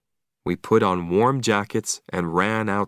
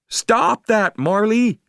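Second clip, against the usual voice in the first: high, loud cry to the dog; alarmed shout; 12 semitones higher, 4 dB louder, slower than usual. A narrator's reading. Owner shouting at Marley drinking seawater, high loud yell. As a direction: yell at Marley to stop, much higher. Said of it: "I cried".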